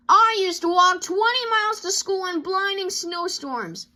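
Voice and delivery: mock parent lecture; preachy lecture. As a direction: lay it on thick like a parent. Papa lecturing the cubs.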